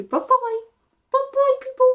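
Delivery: very high sing-song bye-bye. Real, not acted. Making the cat wave bye in high baby talk.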